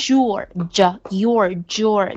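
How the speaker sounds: "your" turned into "jor" after a d; linked j sound. Shown as a demonstration. Teacher shows how linking changes it, slow j sound. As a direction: slowly blend d and "your" into "jor". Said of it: "the your会变成your".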